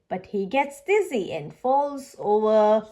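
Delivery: ordinary delivery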